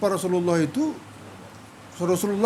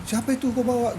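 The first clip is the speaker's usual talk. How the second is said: lightly voiced mother, higher pitch; retold query in a raised voice; questioning mother; 7 semitones higher than usual. Mother asks her son about the guest he brought, higher questioning voice.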